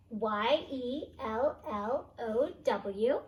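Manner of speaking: slow, letter by letter; spelling slowly. Shown as a demonstration. Teacher spells story words, slow and clear. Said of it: "teacher spells word slowly".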